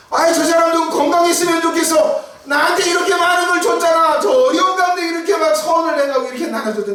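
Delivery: high, loud, eager well-wishing that rushes ahead; gushing blessing. Acted. Neighbors blessing a generous man, gushing and loud. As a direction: act out a neighbor cheering for someone, almost shouting.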